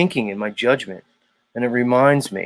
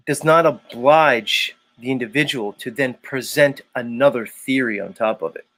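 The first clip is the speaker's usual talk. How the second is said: normal talking voice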